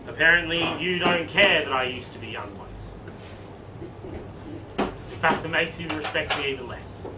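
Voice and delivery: low, soft, deflated principal; mock dejection. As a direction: sink into quiet, hurt resignation. Principal realises nobody cares.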